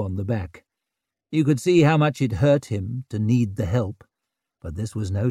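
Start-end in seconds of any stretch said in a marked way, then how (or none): none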